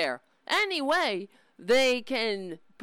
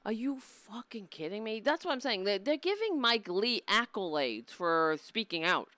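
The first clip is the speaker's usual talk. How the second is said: ordinary talk, nothing marked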